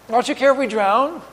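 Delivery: pointed sarcasm rising at the end; sarcastic. Acted. Preacher quoting the disciples to Jesus, sarcastic. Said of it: "you note the sarcasm in there".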